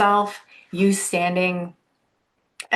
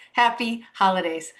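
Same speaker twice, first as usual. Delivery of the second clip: ordinary talk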